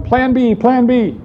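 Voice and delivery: high, frantic cry of alarm; mock panicked yelling. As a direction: play the angels as panicked. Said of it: "angels running everywhere yelling".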